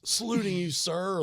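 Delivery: a hint of a sweet old lady saluting; old lady quoted. Storyteller quoting an old lady at the soda machine, light high voice. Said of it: "she goes ... like that".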